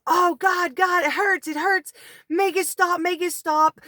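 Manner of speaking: high-pitched mock scream of pain; retold scream. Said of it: "It let him scream out".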